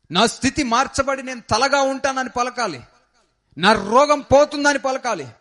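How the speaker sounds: high, loud exhortation from the pulpit; rally the congregation with full-voiced fervour; fervent preaching. Pastor exhorting the congregation to believe, loud fervent preaching.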